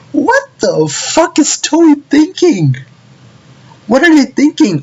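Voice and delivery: very high, squeaky outburst; incredulous. Retold or imitated. Fan questioning Toei over the music, high incredulous squeal. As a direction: squeal in exaggerated disbelief. His outraged reaction to the music.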